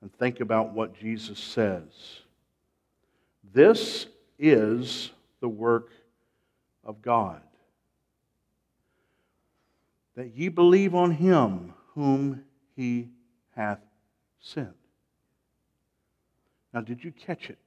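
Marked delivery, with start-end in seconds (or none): 3.5-14.8 slow, deliberate scripture reading with long pauses. Shown as a demonstration. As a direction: read it phrase by phrase, letting each sink in. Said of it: "Let's read it slowly".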